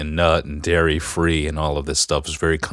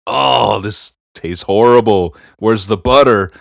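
loud, raised comic complaint; playfully appalled taster; mock disgust; 3 semitones higher, 6 dB louder, slower than usual. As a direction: groan about awful food, hammed-up. Acting out secret disgust at cake.

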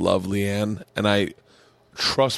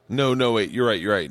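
usual speaking voice

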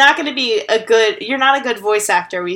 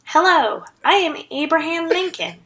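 put-on kid voice; 6 semitones higher than usual. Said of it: "He'd be like".

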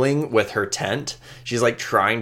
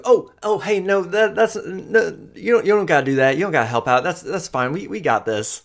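put-on higher, flustered young woman brushing off help; nervous Amoya; 9 semitones higher than usual. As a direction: stammer out that she's fine, pitched up. Flustered NPC Amoya protests.

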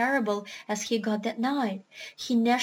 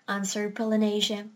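plain reading voice

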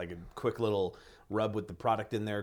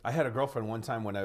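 ordinary delivery

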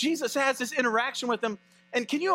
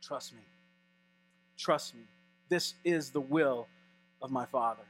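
softer, lower, unhurried; calm a frightened cousin; hushed and tender Jesus saying trust me; quiet reassurance; 7 semitones lower, 4 dB quieter than usual. Retold or imitated. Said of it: "But Jesus just says".

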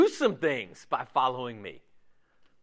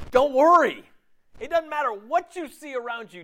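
higher and louder, pressing the point home; emphatic reassurance; 8 semitones higher, 4 dB louder than usual. Shown as a demonstration. Preacher urging the congregation, loud and emphatic. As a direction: hit it hard like a command. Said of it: "That's what he's talking about here".

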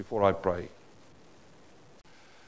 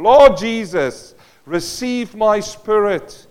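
loud, high and slow, crying out; calling out to God; 9 semitones higher, 11 dB louder, much slower than usual. Retold or imitated. Stephen calling on God as he is stoned, loud slow plea. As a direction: lift it up as a dying plea. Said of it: "as he was calling on God".

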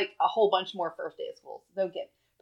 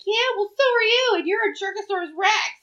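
high, louder imitation of a sassy six-year-old; cheeky kid; 12 semitones higher, 5 dB louder than usual. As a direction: play the cheeky little boy firing back. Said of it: "now he's just like".

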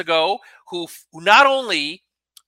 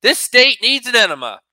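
louder, raised mock scream of frustration; 7 semitones higher, 9 dB louder than usual. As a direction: yell it at the sky, half in jest. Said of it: "when I just wanna scream to the sky".

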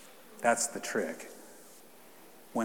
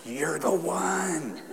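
slow, higher, laughing; baby's gaze voiced; 8 semitones higher, much slower than usual. Pastor voicing baby Isabel's look, slow and laughing. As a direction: voice the newborn's wonder with a giggle. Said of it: "her eyes looked at me like".

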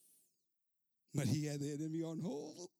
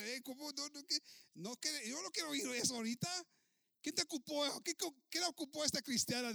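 irritated outburst; 8 semitones higher, 4 dB louder than usual. Retold or imitated. Pastor irritated by secretary.

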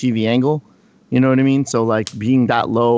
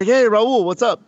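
higher, eager greeting to a player; retold friendly call-out; 9 semitones higher, slower than usual.

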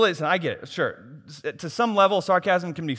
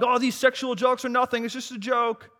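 mock excuse; 9 semitones higher, 5 dB louder than usual.

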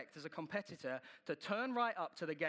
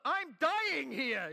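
slow, higher, louder drawn-out plea; exhausted groan; 9 semitones higher, 8 dB louder, much slower than usual. Retold or imitated. Preacher acting his exhausted reply to Chris, slow drawn-out plea. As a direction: drag it out like you're collapsing.

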